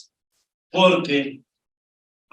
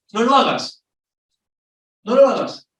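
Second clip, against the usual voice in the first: higher, louder firm warning; emphatic command; 8 semitones higher, 5 dB louder than usual. Real, not acted. Preacher warning the congregation not to do it, loud and firm. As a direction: hammer the rule home twice.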